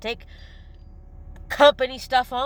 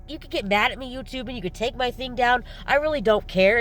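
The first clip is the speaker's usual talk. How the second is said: ordinary talk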